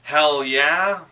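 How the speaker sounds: loud, high "hell yeah" whoop; excited cheer. Real, not acted. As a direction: cheer like your pick just won. Cheering a nomination.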